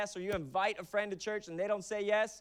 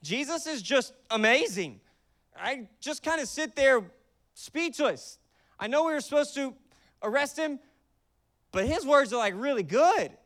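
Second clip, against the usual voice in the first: awestruck guard; 6 semitones higher, 5 dB louder than usual. Retold or imitated. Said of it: "they're like".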